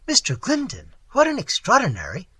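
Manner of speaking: high-pitched astonished exclamation; surprised exclamation. A narrator's reading. Wife startled by the late visitor's name, exclaiming in surprise. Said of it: "exclaimed Missus Mervale".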